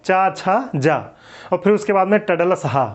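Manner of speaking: usual speaking voice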